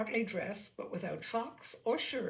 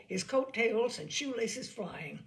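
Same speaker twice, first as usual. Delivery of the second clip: ordinary delivery, nothing marked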